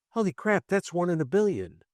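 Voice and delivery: high, stunned disbelief; astonished. Retold or imitated. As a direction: gasp it out in amazement. Stunned by son's words.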